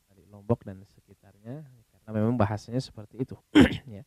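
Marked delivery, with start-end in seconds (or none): none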